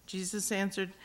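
normal talking voice

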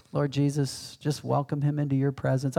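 soothing, peaceful prayer; Roger. Acted. Peaceful pastor praying over a dying friend, soothing. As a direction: imitate a gentle pastor praying, hushed after the outburst. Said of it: "Roger's soothing voice, peaceful voice".

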